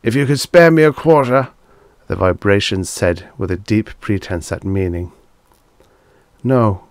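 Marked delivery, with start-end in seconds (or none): 0.0-1.5 louder, higher appeal; lightly voiced panhandler; begging stranger. A narrator's reading. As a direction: press him for a handout. Beggar asking for money.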